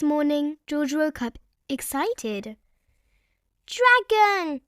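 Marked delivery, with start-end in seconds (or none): 3.7-4.6 high loud eager shout. Acted. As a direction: call it out on waking. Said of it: "he shouted".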